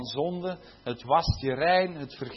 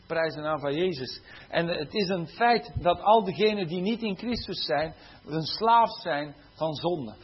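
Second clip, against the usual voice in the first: usual speaking voice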